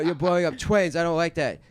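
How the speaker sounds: put-on high, loud childish whine; whiny Batman. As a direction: whine it like a fussy kid. Batman whining in acted bit.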